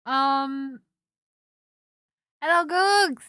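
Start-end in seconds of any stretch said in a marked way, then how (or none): 2.4-3.2 high, loud cheery hello; bright greeting. Real, not acted. Greeting chat viewer.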